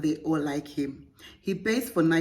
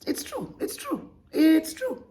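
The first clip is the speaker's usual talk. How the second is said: squeaky mock agreement; 11 semitones higher than usual. Real, not acted. Creator squeakily agreeing with the letter writer. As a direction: pitch it way up, teasing.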